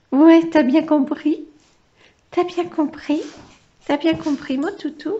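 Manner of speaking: high cooing voice for the dog. Real, not acted. Talking to her dog. (voix gaga aiguë pour parler au chien)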